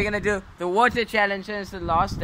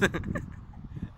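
breathy laugh; 12 dB quieter than usual. Real, not acted. Laughing at funny face.

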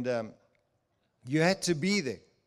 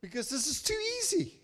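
incredulous; 11 semitones higher, faster than usual. Real, not acted. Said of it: "repeating his doubt".

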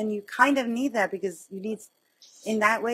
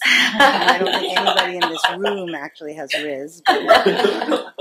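louder, laughing through a joke; 7 dB louder than usual. Real, not acted. Teasing the audience about having Riz, laughing louder. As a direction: tease the room with a laugh.

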